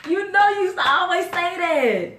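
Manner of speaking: high, loud, laughing protest; high laughing indignation; indignant protest. Real, not acted. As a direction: laugh in disbelief at his denial. Wife protests husband's denial.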